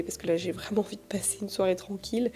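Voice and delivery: soft whisper. Real, not acted. Wants a quiet evening.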